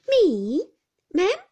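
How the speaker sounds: loud, high startled question; stunned, loud disbelief; astonished servant. A narrator's reading. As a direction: blurt it out, taken aback. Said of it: "returned Peggotty, staring".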